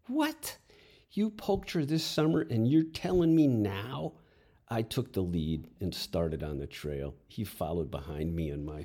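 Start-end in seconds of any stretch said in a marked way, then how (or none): 0.1-4.1 high, loud, incredulous; shocked disbelief. Acted. Boy shocked his friend kept the secret, incredulous high yelp. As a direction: blurt it out, floored by the news.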